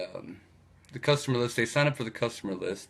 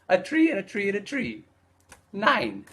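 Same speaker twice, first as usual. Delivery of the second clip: much higher put-on Italian voice, proud punchline; Italian guy voice; 9 semitones higher than usual. Italian guy proudly explaining his drawing of nine, high put-on voice. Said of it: "He says".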